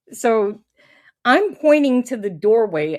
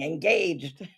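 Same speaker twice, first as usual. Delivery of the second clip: ordinary talk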